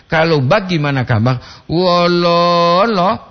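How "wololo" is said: "Wololo" with the middle lo held very long and level; "lo" panjang. Shown as a demonstration. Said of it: "dua O". ("Wololo" dengan "lo" tengah ditarik panjang)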